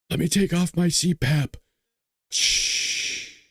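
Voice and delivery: a creepy mock old man, hushed in places; hammed-up Biden impression. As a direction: drawl it like a sleepy old politician. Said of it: "Let me do the Biden voice".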